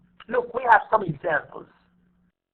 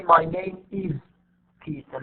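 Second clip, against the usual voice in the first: IS said in full and held, pause before Peter; slow "my name IS ... Peter", leaning on is; wrongly stressed IS; slower than usual. Shown as a demonstration. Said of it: "we don't say".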